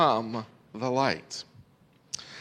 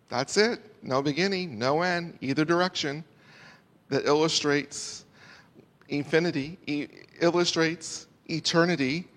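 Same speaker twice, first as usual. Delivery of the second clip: usual speaking voice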